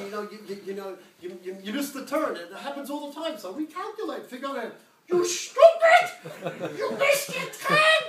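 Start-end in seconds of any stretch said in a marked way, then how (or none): 5.1-8.0 shrieking very high and loud, slowed down; a mean machine scolding the driver; cursing GPS. Retold or imitated. GPS cursing the driver for a missed turn, shrill mock scream. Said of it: "they start cursing me".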